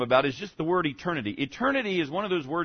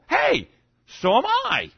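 eager agreement; 12 semitones higher, 4 dB louder, slower than usual. Acted. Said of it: "pastor playing Paul's friendly opener".

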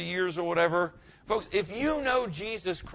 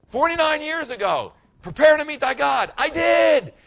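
triumphant shout; 11 semitones higher, 8 dB louder than usual. Real, not acted. Retelling his own conversion.

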